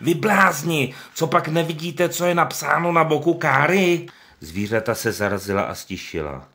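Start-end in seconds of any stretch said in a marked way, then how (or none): 0.0-4.1 much higher, loud exasperated yell; old donkey shouting at the fools; angry shout. Acted. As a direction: scold them at full voice. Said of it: "křičel Benjamin".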